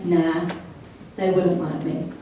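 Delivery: low, soft self-doubt; mumbled-down, defeated aside; dejected inner voice. Acted. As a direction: drop low and quiet, dismissing yourself.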